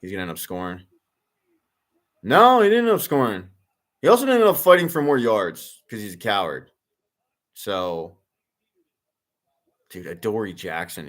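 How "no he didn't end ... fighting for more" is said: high, loud, emphatic "No"; excited correction. Real, not acted. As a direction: call it out as he watches the play. Reacting to live play.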